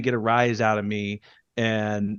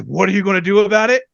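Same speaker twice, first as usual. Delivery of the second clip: fast, higher, louder challenge; mock heated dare; heated taunt; 7 semitones higher, 6 dB louder, faster than usual. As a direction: throw it back like you're ready to swing. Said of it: "If I'm heated and someone says".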